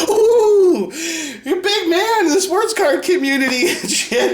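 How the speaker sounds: high, jeering ooh with laughter; mock awe. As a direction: taunt him in a high, laughing voice. Jeering at the rival's boast.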